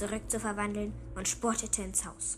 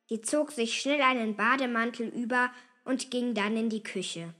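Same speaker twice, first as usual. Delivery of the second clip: ordinary delivery, nothing marked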